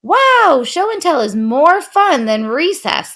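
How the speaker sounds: put-on high, loud children's cheer; excited yell. A narrator's reading. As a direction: shout it out like a happy class. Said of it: "they yelled".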